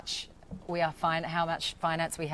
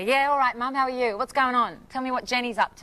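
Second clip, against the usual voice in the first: higher, louder broad Australian phone chat; slipping back into Australian; 6 semitones higher, 5 dB louder than usual. Retold or imitated. Daughter chatting to mum on the phone, broad Australian accent. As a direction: fall straight back into home Australian accent. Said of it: "I'll be straight back there".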